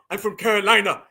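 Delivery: imitating an outsider's put-on Carolina accent; faked Southern accent. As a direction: badly pass for Carolinian with a fake accent. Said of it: "And you can hear through it".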